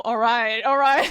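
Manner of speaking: a loose Texan take on the famous catchphrase; Matthew McConaughey impression. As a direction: lean into a laid-back Texan catchphrase. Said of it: "what does he say".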